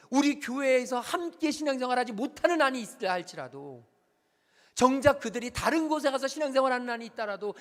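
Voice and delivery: preached rebuke building to a shout, dropping at the end; God's rebuke preached. Retold or imitated. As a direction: thunder God's charge at the pastor. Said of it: "하나님이 말씀하세요".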